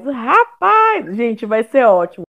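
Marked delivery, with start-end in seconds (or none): 0.0-1.1 high, excited exclamation. Real, not acted. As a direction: burst out with it. Excited reaction to the memes.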